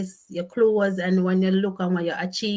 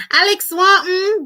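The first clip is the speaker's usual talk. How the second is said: excited; 10 semitones higher, 9 dB louder than usual. Real, not acted. Still laughing, greets viewer.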